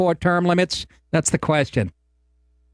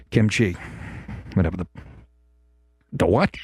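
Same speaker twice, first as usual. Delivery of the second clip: imitating Obama; 6 semitones lower than usual. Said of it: "Obama would have been up there going".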